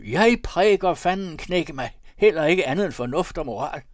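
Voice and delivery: loud, blustering pastor; shouting. A narrator's reading. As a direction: bellow it out emphatically. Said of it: "råbte Rumsø".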